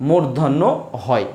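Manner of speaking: slow, spaced-out recitation of the spelling rule; teacher drilling the mnemonic at half speed; slow rule recital. Shown as a demonstration. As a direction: spell out the rule word by word, slowly. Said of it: "গত ক্লাসের পড়া আমি একটা নিয়ম বলেছিলাম".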